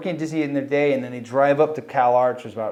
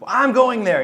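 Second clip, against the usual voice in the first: higher, insistent "I'm going there"; stubborn; 9 semitones higher, 5 dB louder than usual. Retold or imitated. Stubborn teen insisting to the college on the phone, defiant. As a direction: dig in your heels, teen defiance. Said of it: "I'm a stubborn little bastard".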